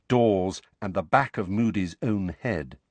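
usual speaking voice

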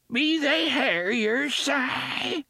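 put-on high, slow, cracked old woman's quaver; Mercy, cracked and quavering. A narrator's reading. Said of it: "she cried in a cracked, quavering voice".

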